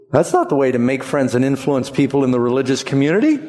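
usual speaking voice

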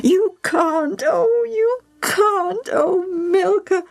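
very high, wailing mother; sobbing. A narrator's reading. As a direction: wail through sobs, begging your daughter. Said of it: "Evie sobbed with her head in her hands".

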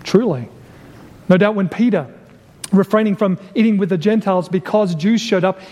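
normal talking voice

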